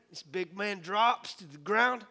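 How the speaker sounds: ordinary talk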